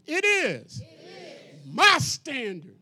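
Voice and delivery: loud, sassy and emphatic, slowing on my standard; with attitude. Shown as a demonstration. Preacher showing the congregation how to say it with attitude. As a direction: belt it out with swagger and punch MY. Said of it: "you ain't say with attitude".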